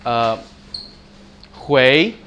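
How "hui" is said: "hui" gliding upward; rising tone. Shown as a demonstration. Teacher showing the second tone, "hui" rising. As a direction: lift the end of "hui" like a question. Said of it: "you can go up".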